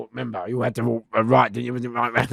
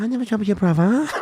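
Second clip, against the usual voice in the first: high timid denial, laughing; mock-scared denial through laughter; 11 semitones higher, 4 dB louder than usual. Playing dumb to the gang member, laughing timid denial. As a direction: fake being shook, can't keep a straight face. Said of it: "I said".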